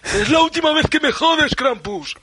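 high, loud hammed-up hero one-liner, ending in a laugh; Papá Noel action hero. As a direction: Santa finally showing up to finish Krampus, a mock hero's shout. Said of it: "aparece ahí Papá Noel".

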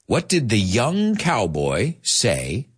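slow, drawn-out riddle; slow joke setup. Shown as a demonstration. A teacher slowly sets up a cowboy joke for students. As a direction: let each word land.

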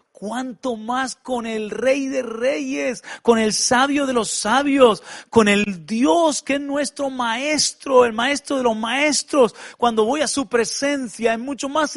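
slow, high, ringing preacher's cry; preaching. Acted. Preacher exalting God to the congregation, slow and high. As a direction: lift each title of God slowly and high.

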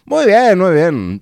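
high, loud "muy bien"; excited cheer. Real, not acted. Host cheering his friend's news, excited.

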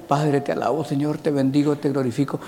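soft, drowsy half-asleep praying; sleepy mumbled prayer. Retold or imitated. Preacher mocks today's sleepy quiet prayer. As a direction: murmur it like you're nodding off.

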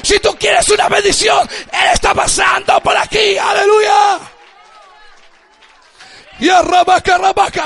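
loud, high, ringing preacher cadence; fervent pastor proclaiming the carpenter passing by; preaching at full force. Acted. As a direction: drive each line home to the congregation. Preaching to the congregation.

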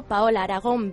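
ordinary talk, nothing marked

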